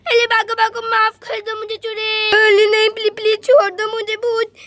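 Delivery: loud frightened cries to run; scared pleading. Acted. Child fleeing the witch, loud frightened pleading. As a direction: beg to be let go in panic.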